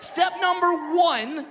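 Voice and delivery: slow announcing. Acted. Reading pamphlet steps.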